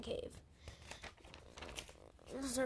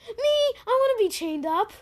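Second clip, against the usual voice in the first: much higher and louder, giddy and overeager; eager dumb volunteer; 11 semitones higher, 15 dB louder than usual. Pretend. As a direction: throw your hand up like an idiot. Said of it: "I'd be stupid as hell and just say".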